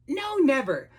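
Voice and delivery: trembling. Acted. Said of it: "made him tremble".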